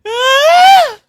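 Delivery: high, rising squeal; excited squeal. Real, not acted. Streamer giddy on stream, squealing. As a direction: squeal out after a laugh.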